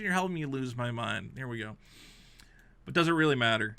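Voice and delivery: usual speaking voice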